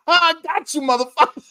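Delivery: high, cackling gotcha; laughing. Retold or imitated. Retelling a prankster laughing at his friends, high and laughing. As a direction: crow it through high laughter. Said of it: "he starts laughing".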